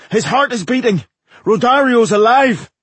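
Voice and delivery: high, loud cry of joy; excited exclamation. A narrator's reading. Dwarf announces that the impresario survived, excited shout. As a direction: cry out the news, voice shooting up. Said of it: "exclaimed Fergus".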